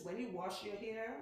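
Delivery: normal talking voice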